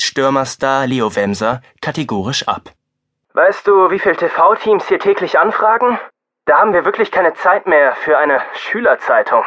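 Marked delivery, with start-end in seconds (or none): 3.3-9.4 brusque press officer. A narrator's reading. Press officer refuses, voiced.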